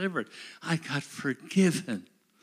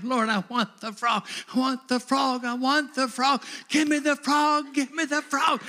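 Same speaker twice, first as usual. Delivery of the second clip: rising, ever louder begging cry, higher; desperate pleading; 8 semitones higher, 4 dB louder than usual. Retold or imitated. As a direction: beg God louder each time until shouting. Pleading prayer retold in sermon.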